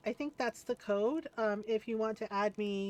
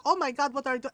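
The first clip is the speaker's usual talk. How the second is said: put-on louder mom with Filipino accent; Filipino mother; 4 semitones higher, 6 dB louder, much faster than usual. Filipino mom walking in on her son, accented alarm. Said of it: "our little tiny Filipino mother".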